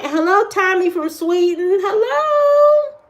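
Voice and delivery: high, sung-out hello to a newcomer; gushing greeting. Real, not acted.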